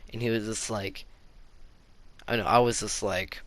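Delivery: ordinary talk, nothing marked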